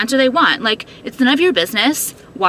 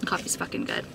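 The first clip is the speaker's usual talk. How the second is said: hushed aside to the viewers; softly whispered delight over the coffee; whispered praise; 5 semitones lower, 14 dB quieter than usual. Real, not acted. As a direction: whisper it, much softer, savouring the coffee. Tasting coffee, quietly pleased.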